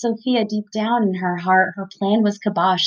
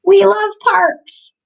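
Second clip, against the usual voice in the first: cheerful sign reading; 11 semitones higher, 5 dB louder, slower than usual. Acted. Mother reads sign enthusiastically.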